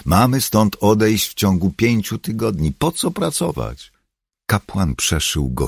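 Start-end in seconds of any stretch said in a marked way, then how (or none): 0.0-3.9 high, agitated protest; exasperated, pitched-up challenge; objecting. A narrator's reading. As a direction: argue that there is no point. Dale objects to the leader.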